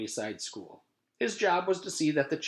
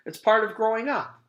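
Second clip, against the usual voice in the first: put-on matter-of-fact kid; 11 semitones higher, 6 dB louder than usual.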